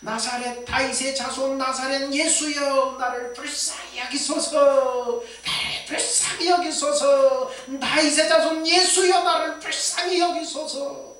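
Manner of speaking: high, loud, drawn-out plea; loud crying out. Retold or imitated. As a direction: shout for mercy, stretching each phrase. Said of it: "큰 소리로 외칩니다".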